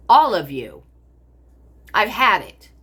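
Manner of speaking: loud furious outburst; loud, fed-up shout at everyone; angry. Real, not acted. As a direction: hit it hard, fed up. Furious rant at family.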